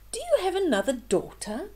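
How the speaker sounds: a hint of an eager prince; prince asking. Prince asking the stepmother, lightly voiced, quick. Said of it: "the handsome prince asked".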